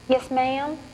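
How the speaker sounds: sugary innocent reply; butter wouldn't melt; so sweet. Acted. Girl answers the saleslady sweetly to seem innocent. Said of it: "I say it so sweet".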